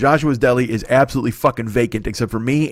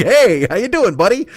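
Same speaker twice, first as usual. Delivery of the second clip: high, lilting mock-friendly reply; retold friendly greeting; 8 semitones higher, 4 dB louder than usual. Insulted stranger answers the old person, high-pitched mock friendliness. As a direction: greet the old-timer in a raised, imitated voice. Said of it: "And you're just like".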